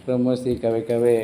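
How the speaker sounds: normal talking voice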